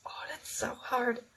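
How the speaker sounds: breathy high whisper, slowed down. Real, not acted. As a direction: whisper it as if overwhelmed by the choice. Whispered reply to Queen question.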